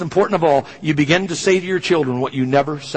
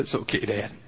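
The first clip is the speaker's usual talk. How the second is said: much softer, choked reassurance; crying softly; 6 semitones lower, 11 dB quieter than usual. Retold or imitated. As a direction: hold back tears while you say it. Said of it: "so he couldn't see I was crying".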